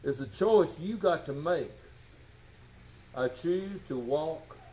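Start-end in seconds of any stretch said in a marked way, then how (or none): 3.1-4.6 slow softer vow, sinking low; slow solemn vow. Real, not acted. Preacher declaring his choice to walk in faith, slow solemn. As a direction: declare it slowly and humbly. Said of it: "solemn declaration".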